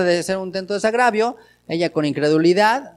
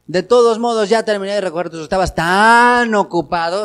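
sarcasm; 3 semitones higher, 7 dB louder than usual. Retold or imitated. Wife to husband, sarcastic about his being busy. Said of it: "eso es sarcasmo".

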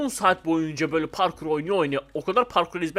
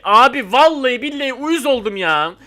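loud, high exasperated swearing; loud annoyed vow; fed-up outburst; 6 semitones higher, 7 dB louder than usual. Real, not acted. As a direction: blurt out your irritation. Fed up with himself.

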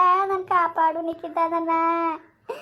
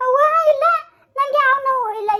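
strained denial; 6 semitones higher, 3 dB louder, faster than usual. Acted. Pained woman denying her pain.

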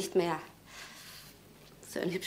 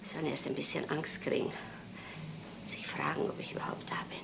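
softer, whispering while hiding; hushed whisper; 2 semitones lower, 13 dB quieter, faster than usual. Acted. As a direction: keep them waiting in a sly whisper. Hiding from visitors at the door.

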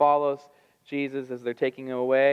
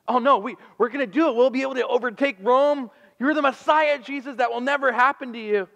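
higher, louder, eager protest; eager correction; 10 semitones higher, 4 dB louder than usual. Retold or imitated. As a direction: brashly cut in to set Jesus straight. Peter corrects Jesus eagerly.

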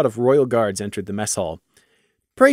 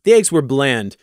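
usual speaking voice

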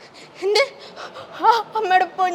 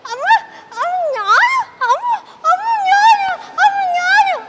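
put-on child calling mother; 12 semitones higher than usual.

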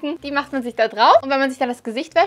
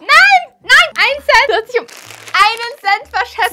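high, loud outraged cry; loud shocked protest; dismayed shout; 10 semitones higher, 10 dB louder than usual. Real, not acted. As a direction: shout in disbelief over one cent. Misses guess by one cent.

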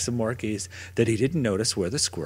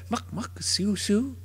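higher squirrel squeaks; squirrel chatter; 9 semitones higher, slower than usual. Acted. Squirrel looking at the sunken boat, squeaky chatter.

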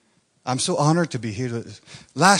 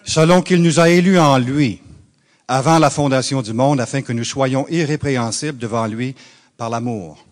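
a preacher's scripture reading; reading scripture in French; 5 dB louder than usual. Real, not acted. Reading the French Bible to the congregation, steady preacher's read. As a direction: read the verse aloud in French, steady and firm. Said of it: "You want me to read it in French?".

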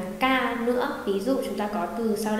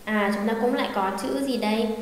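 ordinary talk

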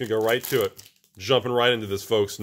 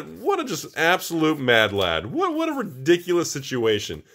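high-pitched amazed exclaiming; incredulous excitement; 8 semitones higher than usual. Real, not acted. Host telling patron about nine mythics, high amazed disbelief. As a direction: marvel at it, voice pushed up high. Marvelling at the patron's lucky boxes.

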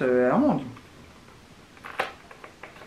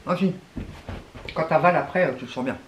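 chewing between words; snack in mouth; mouth full while talking. Real, not acted. Seen 3: Woman holds stick snacks by her mouth, lips working as if chewing.

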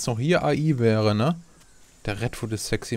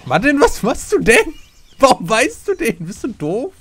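loud, high-pitched incredulous yell; exasperated scolding; 13 semitones higher, 8 dB louder than usual. Real, not acted. Player scolds teammate for a reckless move, exasperated. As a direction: shout in shrieking disbelief at the blunder.

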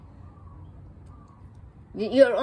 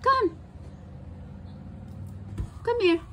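high coaxing call; 10 semitones higher, 7 dB quieter, faster than usual. Real, not acted. Coaxing the pet.

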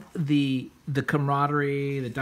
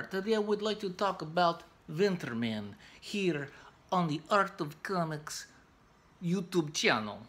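a bad fake Russian accent held steady and a little softer; hammed-up Russian accent, talked a bit quieter; horrible Russian accent; 5 dB quieter than usual. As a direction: keep up the mock Russian accent through the channel plug. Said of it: "Sorry about my horrible Russian, uh, accent".

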